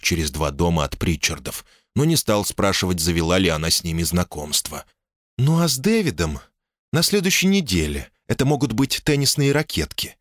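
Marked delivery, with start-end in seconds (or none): 5.4-10.2 lightly voiced wife, higher; calm, sensible woman speaking up high; woman's voice.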